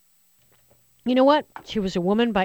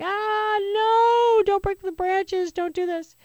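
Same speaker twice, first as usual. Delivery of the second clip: much higher, pleading cry over the branches; mock panicked gardener; 12 semitones higher, 5 dB louder, slower than usual. Host playing a gardener begging the snow not to break branches, high mock panic. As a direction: squeal in fake alarm to save the lilacs. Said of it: "I'd be like".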